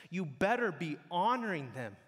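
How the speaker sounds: usual speaking voice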